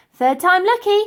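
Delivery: ordinary delivery, nothing marked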